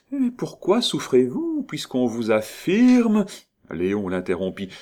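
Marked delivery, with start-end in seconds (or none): 0.1-3.3 teasing. Acted. Countess teasing suffering lover.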